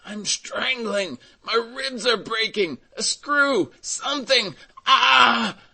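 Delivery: very high, strangled shrieks; shrieking. A narrator's reading. Old carter being crushed under the cart, shrieking. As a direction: shriek in agony, climbing to a loud scream. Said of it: "rattled in the throat and shrieked".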